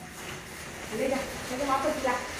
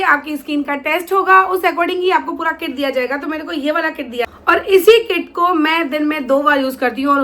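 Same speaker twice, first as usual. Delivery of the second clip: lively promo pitch, a bit louder; ad read; 3 semitones higher, 10 dB louder than usual. Acted. Vlogger promoting a skincare kit to viewers, promo voice. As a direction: sell the app's perks.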